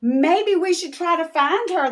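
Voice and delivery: put-on high, loud children's plea; kids' voices. The kids planning to search, high and eager. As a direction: sound like eager little kids. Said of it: "they said".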